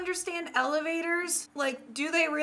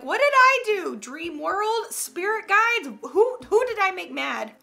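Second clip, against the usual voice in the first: mock indignant plea; 5 semitones higher, 4 dB louder than usual. Pleading with dream spirit guides.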